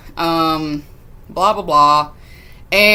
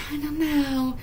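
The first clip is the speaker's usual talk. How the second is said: small, high, soft voice; shy girl; 8 semitones higher, 8 dB quieter than usual. Retold or imitated. Hannah answering the partygoers, shy high voice. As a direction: mimic a hesitant girl, high and quiet.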